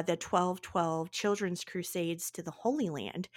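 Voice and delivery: ordinary delivery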